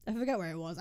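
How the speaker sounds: normal talking voice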